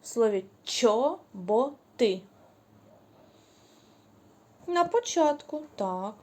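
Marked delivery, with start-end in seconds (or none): 0.6-2.2 «чоботи» stretched slowly, the «ч» clear; slow, drawn-out teaching pronunciation; drawn-out word. Shown as a demonstration. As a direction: say «чоботи» very slowly for the sound hunt. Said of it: "Де ви чуєте звук «ч»".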